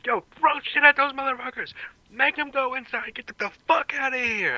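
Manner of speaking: high-pitched rabble-rousing yell, volume held back; acted yell. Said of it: "and yell out".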